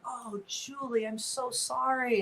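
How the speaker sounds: mock-tender retelling of the chaplain's comfort; imitating a comforting chaplain. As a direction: gently console her like a caring chaplain. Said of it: "so he was like".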